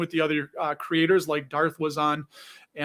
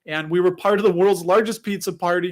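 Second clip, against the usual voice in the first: ordinary delivery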